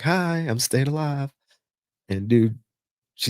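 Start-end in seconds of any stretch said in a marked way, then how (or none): 0.0-1.3 higher and slow, innocently sarcastic; mock-innocent reply to the squad leader; cheeky retort. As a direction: play dumb with a sing-song sweetness. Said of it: "And I'm like".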